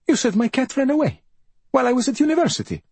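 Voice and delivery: high-pitched, affronted son; high, startled accusation; indignant disbelief. A narrator's reading. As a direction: voice leaps up, challenging his mother's story. Son catches his mother's lie.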